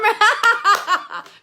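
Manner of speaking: loud, high real laugh; sudden high belly laugh; burst of laughter. Real, not acted. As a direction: crack up mid-word. Cracks up at typed phonetic spelling.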